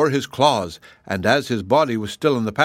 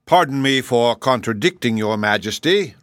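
usual speaking voice

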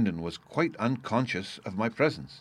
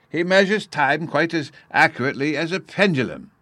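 high, loud scholarly delight; enthusiastic professor; 9 semitones higher, 7 dB louder than usual. Acted. Professor counting Bruno's oscillations, still enthusiastic. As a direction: marvel aloud, pitched well up.

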